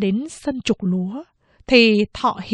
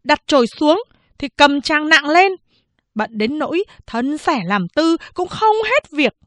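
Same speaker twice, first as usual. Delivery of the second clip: higher, animated scolding mother-in-law; mẹ chồng đay nghiến; 6 semitones higher than usual. Acted. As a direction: lay into the daughter-in-law, pitch up. (giọng mẹ chồng cao, mắng nhiếc)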